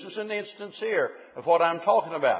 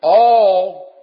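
"All" shouted out much louder; stress on ALL; 11 dB louder than usual. Shown as a demonstration. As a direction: hit "All" hard as a proclamation. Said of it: "But notice again".

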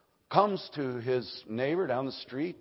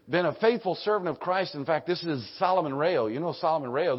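raised, driving preacher's delivery; forceful preaching; 4 semitones higher, 6 dB louder than usual. Real, not acted. Preacher telling congregation of missionaries in line, forceful preaching. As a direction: press the point home loudly.

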